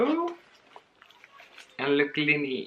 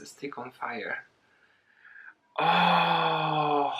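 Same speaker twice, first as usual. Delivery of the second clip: normal talking voice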